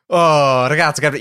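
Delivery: imitated Italian exasperation; pissed-off Italian mumbling. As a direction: grumble like a fed-up Italian woman. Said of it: "she's mumbling in Italian. And so she's all pissed off".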